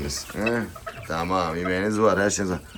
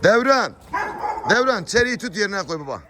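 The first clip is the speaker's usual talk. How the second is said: calling out; 13 semitones higher than usual. Real, not acted. Owner tells helper to put Çeri back, high calling shout. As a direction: shout an order across to a helper.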